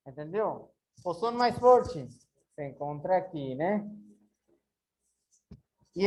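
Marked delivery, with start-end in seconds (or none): none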